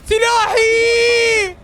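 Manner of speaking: high, loud, very slow drawn-out cry; long scream. Real, not acted. Streamer screaming for his player in a clutch moment, long and loud. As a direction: stretch the word into a scream of joy.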